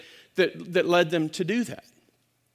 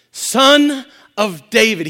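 loud, slow desperate cry; 3 semitones higher, 11 dB louder, slower than usual. Acted. Blind man crying out to Jesus, loud slow plea. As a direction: call out over the crowd. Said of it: "begins to cry out".

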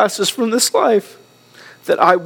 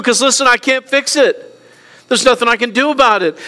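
full-force preaching; 5 semitones higher than usual. Acted. Pastor urging his church to trust God, fervent preaching. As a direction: drive it home to the congregation.

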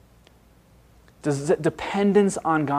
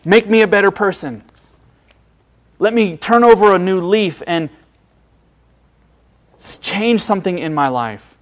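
mock agonized plea; 5 semitones higher, 6 dB louder than usual. Agonized believer begging God.

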